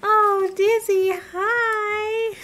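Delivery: high, loud happy squeal; squealing with joy. Real, not acted. To Dizzy the new villager, a high loud happy squeal. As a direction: shriek with delight at the cute villager.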